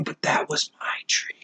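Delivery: hushed mock-wounded whisper; soft, voiceless whisper, playing pitiful; whispered koala lament. Koala mourning its lost tree.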